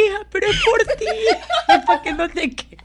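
high, loud, laughing mom voice; mock mother. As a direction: play a doting mother through laughter.

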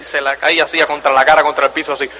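ordinary talk

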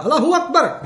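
grito agudo de broma; grito imitado. Retold or imitated. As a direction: imita un grito al lanzarte cuesta abajo. (grito fingido en tono muy alto)

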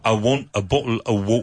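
Cockney bottle of water, glottal t's; Cockney accent. Shown as a demonstration. Teacher demonstrates a Cockney phrase to students. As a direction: say it like an East Ender. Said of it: "the classic Cockney accent".

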